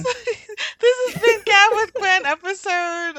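high, loud giggly outro; laughing sign-off. Real, not acted. A host laughing as she closes her episode, giggly and loud. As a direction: laugh through the show's sign-off.

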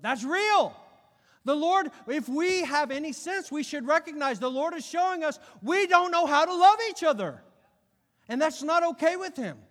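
loud, high, urgent preacher's cry; full-force preaching. Real, not acted. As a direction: cry it out as a rebuke to the church.

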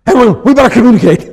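husband teasing his wife with a stiff, laughing mock line. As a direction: poke fun at a formal tone. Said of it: "tease my wife a little bit".

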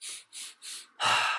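long breathy sigh out through the mouth. Shown as a demonstration. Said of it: "relax side out through the mouth".

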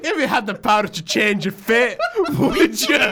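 laughing mock drama.

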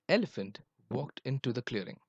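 ordinary delivery, nothing marked

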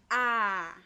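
wide open demonstration A; open A demo. Shown as a demonstration. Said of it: "se forma abriendo mucho la boca".

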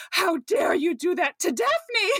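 slow, high mock outrage; indignant confrontation. Niles confronting the guy for Daphne's sake, mock indignant. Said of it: "he confronts them".

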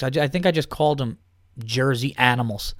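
ordinary talk

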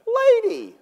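high, loud comic outburst; exasperated mock yell. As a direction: cry out at the wife in hammed-up exasperation. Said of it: "I'm going".

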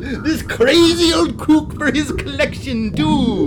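gloating villain. Acted. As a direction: crow it triumphantly, laughing. Villain gloating over captives.

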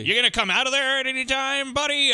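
lightly voiced agent coaxing a friend out; mock coaxing agent. Mock agent calling into bathroom.

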